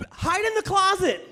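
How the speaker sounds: high, loud mock shriek; panicked order. [name] to Mike as mom approaches, panicked yell.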